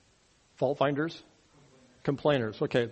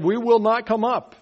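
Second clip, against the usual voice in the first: higher, louder defiant We will not come up; defiant refusal; 6 semitones higher, 5 dB louder than usual. Retold or imitated. Rebels refusing Moses, higher and louder defiant shout. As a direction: throw back a defiant refusal. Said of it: "But they said".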